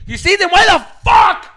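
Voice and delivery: full shouted reenactment of anger; high, very loud exasperated yell; too loud. Retold or imitated. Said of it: "you're getting way too loud".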